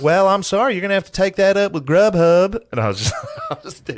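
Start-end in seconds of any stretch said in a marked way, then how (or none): 0.0-2.6 high, loud Southern whine; Zach Galifianakis southern accent. Acted. Pizza manager brushing off a complaining customer, hammed-up Southern accent. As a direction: refuse in an exaggerated Southern lilt. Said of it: "Zach Galifianakis do his southern accent".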